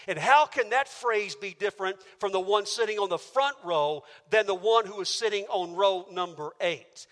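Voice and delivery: ordinary delivery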